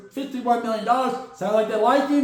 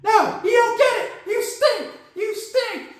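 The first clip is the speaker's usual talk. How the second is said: mock outburst; 12 semitones higher than usual. Genie insults Rodgers.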